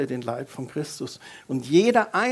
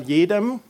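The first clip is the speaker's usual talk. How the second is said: jedem higher and louder with a rise; stress on jedem; 4 semitones higher, 7 dB louder than usual. Shown as a demonstration. Pastor prompting congregation to repeat, stressed jedem. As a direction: sing out „jedem“ for the church to repeat. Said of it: "sag mal".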